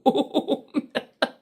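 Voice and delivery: high burst of real laughter; laughing. Real, not acted. Laughing at her own hotel story. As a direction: crack up at the memory.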